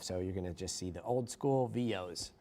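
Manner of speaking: ordinary delivery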